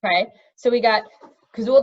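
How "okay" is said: plain reading voice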